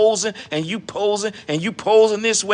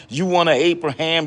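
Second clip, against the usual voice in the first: usual speaking voice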